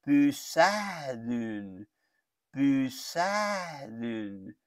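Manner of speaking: "Pusadun" very slow with rounded lips; slow Finnish drill. Shown as a demonstration. Teacher models the word for the student, very slow. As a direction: draw "pusadun" out with pushed lips. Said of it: "poner la boquita así".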